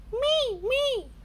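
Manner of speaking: put-on high eager child cry; volunteer eagerly; eager Rebecca Rabbit. A narrator's reading. Rebecca Rabbit volunteers as goalkeeper, high eager cry. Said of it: "cries Rebecca Rabbit".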